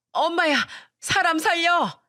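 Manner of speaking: hammed-up yelp while fleeing; panicked cry. A narrator's reading. Mother-in-law running from a fight, acted panicked cry. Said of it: "소리를 지르면서 주랭랑을 쳐버리더군요".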